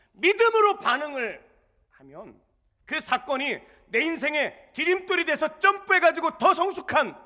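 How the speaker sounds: high, loud fervent preaching; preaching at full force. Acted. Pastor urging the congregation, fervent loud preaching. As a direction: drive the point home to the congregation.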